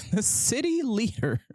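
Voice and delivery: laughing through the words city leader. Real, not acted. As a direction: chuckle as you correct yourself. Laughs while correcting himself.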